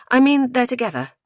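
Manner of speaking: high, emphatic explanation; explaining woman. A narrator's reading. Woman spells out the romance.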